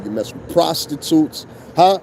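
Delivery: usual speaking voice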